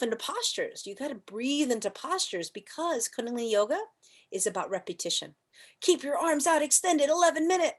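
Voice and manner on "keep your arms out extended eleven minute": teacher's command. Retold or imitated. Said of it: "teacher command quoted".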